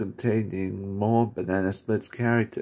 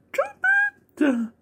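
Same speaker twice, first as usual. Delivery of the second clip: very high, loud trumpet squeal; trumpet call; 4 dB louder than usual. Retold or imitated. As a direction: blare it like a circus elephant. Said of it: "going to the circus and going".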